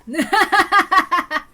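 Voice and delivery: loud self-satisfied laugh; pleased laugh. Acted. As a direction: laugh at your own joke. Character laughs.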